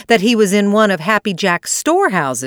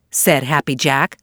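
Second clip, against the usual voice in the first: ordinary delivery, nothing marked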